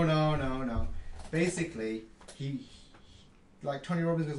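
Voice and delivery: normal talking voice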